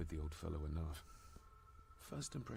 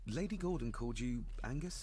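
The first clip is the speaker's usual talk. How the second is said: surprised; 4 semitones higher, 3 dB louder than usual. Acted. Character surprised at the name.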